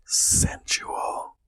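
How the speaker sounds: whispered seductive Sensual; breathy whisper. Shown as a demonstration. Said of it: "more sensual".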